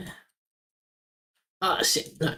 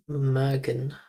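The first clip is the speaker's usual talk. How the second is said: ordinary delivery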